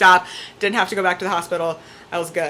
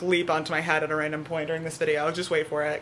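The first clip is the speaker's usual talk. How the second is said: usual speaking voice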